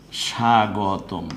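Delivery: স্বাগতম spoken very slowly, each sound drawn out; slow demo word. Shown as a demonstration. Teacher slowly sounding out স্বাগতম for students. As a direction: stretch স্বাগতম out slowly for the class. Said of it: "অথবা যদি বলি".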